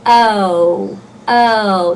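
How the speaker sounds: drawn-out "O", gliding like "oh-oo"; two-vowel O. Shown as a demonstration. Teacher demonstrating the diphthong, long gliding "O". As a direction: stretch each "O" so both vowels show. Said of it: "There's no two vowels".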